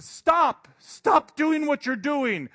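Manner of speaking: high, loud frantic yell; shouted stop. Retold or imitated. Telling the computer to stop erasing lines, frantic shout. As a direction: yell at the screen to make it stop.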